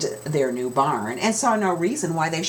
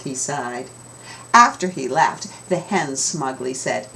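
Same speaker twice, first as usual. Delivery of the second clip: plain reading voice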